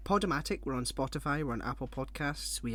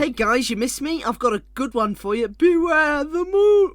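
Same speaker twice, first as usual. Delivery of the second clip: high, loud hammed-up joke delivery; mock joke-teller; 12 semitones higher, 14 dB louder than usual. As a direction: burst in noisily, belt the punchline. Acts out telling friends the joke.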